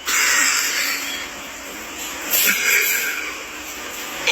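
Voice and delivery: long tearful exhale; weary sigh. Real, not acted. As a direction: let out the despair. Despair over debt.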